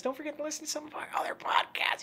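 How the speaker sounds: high, strained squeeze on last air; running out of breath. Real, not acted. Host rattling off podcast plugs, running out of breath. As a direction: push through on an emptying lung. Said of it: "I just ran out of breath".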